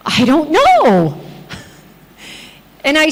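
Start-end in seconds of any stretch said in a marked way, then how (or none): 0.1-1.2 slow, stretched "know" that arches up and drops; evasive patient. Retold or imitated. Patient asked if she has pain now, evasive. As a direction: imitate her dragging out the non-answer. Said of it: "that's the way she said it".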